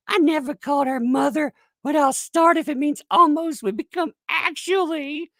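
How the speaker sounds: high mock-earnest voice; squeaky put-on protest; mocking detractor. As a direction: imitate an indignant critic way up high. Continues quoting the mocked detractors.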